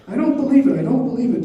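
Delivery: high, loud repeated protest; acted agitated outburst; agitated disbelief. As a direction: insist against the news, loud and high. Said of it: "Dolly's agitation had an effect".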